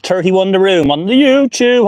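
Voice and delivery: ordinary talk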